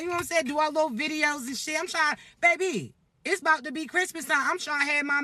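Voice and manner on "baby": ordinary talk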